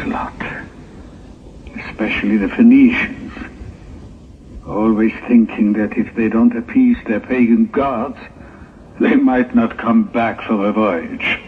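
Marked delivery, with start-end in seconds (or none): none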